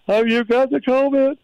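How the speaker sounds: high, put-on voice of a clinic worker; squeaky mocking official; mock doctor voice. Mocking the testing center staff.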